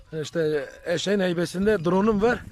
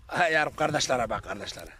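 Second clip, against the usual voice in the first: ordinary talk, nothing marked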